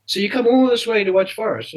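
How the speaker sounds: retold incredulous question; amazed question. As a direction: voice someone astonished at a fan's long journey. Club shop man's amazed question.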